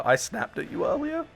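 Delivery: squeaky startled question; surprised disbelief. Real, not acted. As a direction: genuinely caught off guard by the claim. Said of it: "why you snapped at me earlier".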